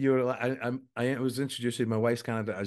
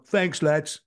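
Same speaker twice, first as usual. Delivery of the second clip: put-on a louder, light English accent thanks; 5 semitones higher, 5 dB louder than usual. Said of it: "I was like".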